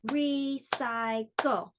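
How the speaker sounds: "Recycle" very slowly in three parts; one long beat for each syllable of "Recycle"; split into syllables. Shown as a demonstration. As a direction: stretch "Recycle" into re, cy, cle. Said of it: "recycle has three syllables".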